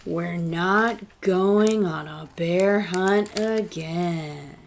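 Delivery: very slow, drawn-out final line; slow closing line. Acted. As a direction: let the last line land slowly and heavily.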